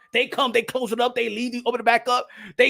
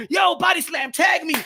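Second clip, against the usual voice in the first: mock wrestling call; 6 semitones higher than usual. Kids playing wrestling.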